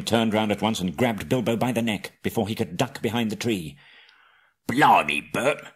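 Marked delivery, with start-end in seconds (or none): 4.7-5.6 loud, slow troll calling out to Bert; troll shouting over his catch; hammed-up loud troll cry; excited troll. A narrator's reading. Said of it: "said William".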